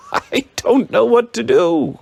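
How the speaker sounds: laughing-crying outburst of relief; cried, laughing and crying. A narrator's reading. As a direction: blurt it out overwhelmed with joy. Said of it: "he cried, laughing and crying".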